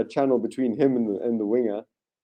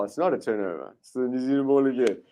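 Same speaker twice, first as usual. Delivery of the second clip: ordinary talk, nothing marked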